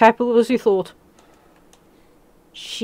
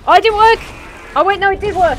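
excited outburst; 9 semitones higher, 4 dB louder, faster than usual. Real, not acted. Rocket launcher shot lands.

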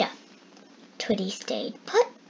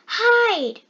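high, loud cry to hide; cried out; 10 semitones higher, 7 dB louder than usual. A narrator's reading. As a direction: cry out in a fright. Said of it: "cried Twitty".

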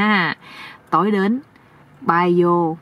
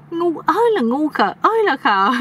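higher-pitched exasperated ribbing; mocking scolding; 8 semitones higher than usual. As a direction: chide the fool in a raised pitch. Uncle mocks the foolish nephew. (giọng cao, chê trách)

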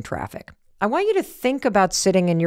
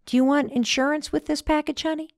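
higher, sugary clerk voice asking about insurance; sweet clerk; 7 semitones higher, 4 dB quieter than usual. Retold or imitated. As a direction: customer mimicking the overly friendly postal clerk, higher sugary voice. Postal clerk voiced in story.

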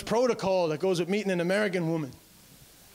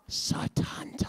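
hushed, breathless naming; voiceless awed whisper of the name; slower than usual. Acted. Whispered name before loudspeaker call.

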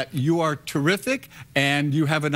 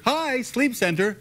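high, slow, bright-toned greeting; cheery receptionist; 8 semitones higher, slower than usual. Retold or imitated. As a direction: sing it out like a friendly receptionist. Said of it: "you think it was".

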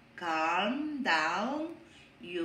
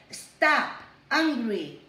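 firm stop; 2 semitones higher, 6 dB louder, slower than usual. Shown as a demonstration. Teacher showing class how to stop anger. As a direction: stop the anger like a red light. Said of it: "Aurora, remember".

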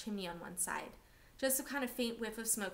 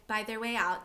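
ordinary delivery

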